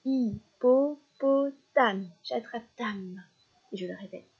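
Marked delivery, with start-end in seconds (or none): 0.1-2.1 "hippopotame" slowly, higher, in four separate beats; syllable by syllable. Shown as a demonstration. Teacher counting syllables for a child, "hippopotame" slowly split. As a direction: break "hippopotame" into hip-po-po-tame, evenly spaced. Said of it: "un long mot avec quatre syllabes".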